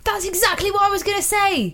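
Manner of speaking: high-pitched burst of agreement; eager agreement. Pretend. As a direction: jump in as if delighted. Mock-eager agreement after long pause.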